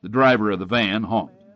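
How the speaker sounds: usual speaking voice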